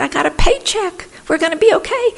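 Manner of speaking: high happy insistence; bubbling reassurance; excited. Retold or imitated. Excited retold call.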